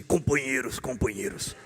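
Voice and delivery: low, mock union boss addressing the comrades; hammed-up leader opening a speech in a deep voice; ant leader's rallying address. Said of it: "o líder disse".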